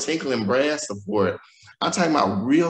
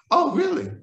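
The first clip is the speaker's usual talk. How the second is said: mom surprised. Retold or imitated. Mom reacts to HIV news.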